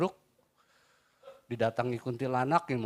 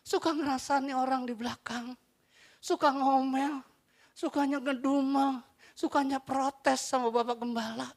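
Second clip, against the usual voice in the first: mocking grumble; 13 semitones higher than usual.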